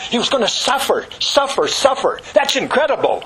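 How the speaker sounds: high-pitched, astonished preaching; incredulous. Acted. Preacher voicing prophets' shock at a suffering Messiah, incredulous. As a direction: insist in rising disbelief at the news.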